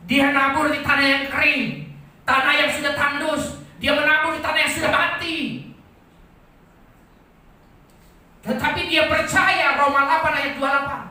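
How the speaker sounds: loud, high-pitched sermon cry; impassioned preacher in full flight; full-force preaching. Real, not acted. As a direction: proclaim it at the top of your voice.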